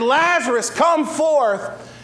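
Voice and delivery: Jesus calling into the tomb, commanding. Acted. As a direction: raise the voice high and call him out. Jesus calls Lazarus from the grave.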